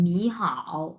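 নি rising, then a low dipping হাও; Mandarin tone demo. Shown as a demonstration. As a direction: model নি হাও as tone two plus tone three. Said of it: "দুইটা একসাথে কিভাবে উচ্চারণ করব".

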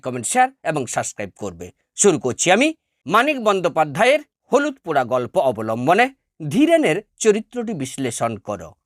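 ordinary talk, nothing marked

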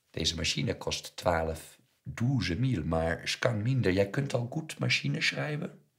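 haggling shopkeeper. A narrator's reading. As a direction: play the eager old dealer making an offer. Voices Mossel the shopkeeper. (sjacherende winkelier, luid en levendig)

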